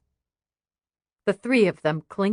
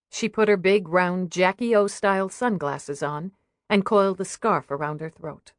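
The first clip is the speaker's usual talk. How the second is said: ordinary delivery, nothing marked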